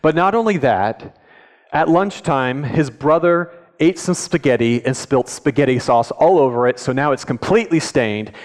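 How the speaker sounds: ordinary delivery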